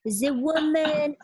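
slow, deliberate imitated French accent; thick French accent. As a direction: draw it out like a Frenchman explaining. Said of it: "this thick French accent".

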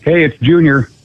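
usual speaking voice

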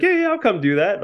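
lightly voiced A-Rod agreeing to tag along; mock eager star. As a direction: toss it off like it's no big deal.

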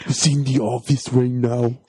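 slow, put-on action-star voice; Schwarzenegger. Co-host as dad covering about the toy, hammy impression. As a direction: draw it out as a mock movie dad. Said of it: "he's like".

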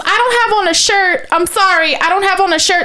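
high-pitched imitation of an apologetic woman; lady neighbor. Lady apologizes through her cracked door, imitated in high voice. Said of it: "it's a lady. She cracks her door".

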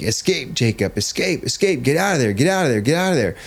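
high, urgent mock yell without full volume; retold coach yelling. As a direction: imitate a coach urging a struggling kid. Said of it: "the coach is yelling at my kid".

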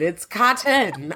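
COTTON drawn out slowly in two syllables. Shown as a demonstration. Baffled co-host insists to laughing friends, over-enunciated COTTON. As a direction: spell out COTTON by ear, both syllables slow and distinct. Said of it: "Two syllables".

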